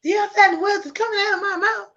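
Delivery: high, fast movie quote; film line quoted fast and high; Chris Tucker. Retold or imitated. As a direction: rattle it off like the Rush Hour line. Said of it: "That, that's a film".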